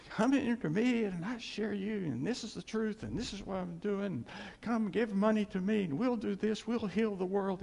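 high singsong money-seeking preacher; mock preacher pitch. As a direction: reel off the sales pitch, voice held high.

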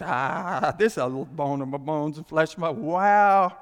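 overwhelmed and tripping over words; lost for words. Acted. Adam seeing Eve, loud stunned stammer. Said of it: "He goes, he lost for words".

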